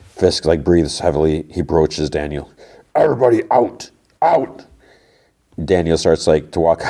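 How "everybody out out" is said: high, forceful mock shout; raised-voice command, acted out; shouted order. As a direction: play an angry boss clearing the room. Mayor orders staff out.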